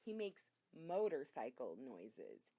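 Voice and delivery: plain reading voice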